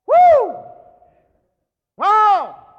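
loud, high whoop; ecstatic cry. Real, not acted. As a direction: shout out in amazement. Ecstatic exclamation.

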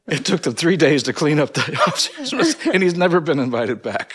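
talking through laughter; laughing. Real, not acted. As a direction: laugh while telling the punchline.

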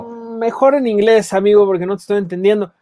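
mock polite. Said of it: "Y yo".

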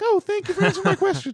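very high, fast mock thanks; sarcastic kid. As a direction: gush it with fake gratitude. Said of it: "It's like".

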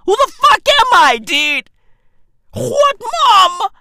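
high, loud self-amazed yelling; hammed-up high-pitched boasting; crowing disbelief. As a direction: cry it out, amazed at yourself. Crowing over his own title idea.

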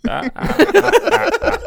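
very high, loud ah ah ah; high cackle. Acted.

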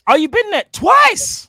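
loud, slow amazed shout; surprised. Real, not acted. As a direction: stretch it out in amazement. Hearing friend visited Barbados twice.

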